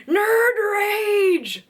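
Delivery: high, loud mock-rage yell; Tina Fey nerd rage. Quoting Tina Fey on fandom, loud mock-rage yell. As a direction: shout it like a sitcom outburst. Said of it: "As Tina Fey goes".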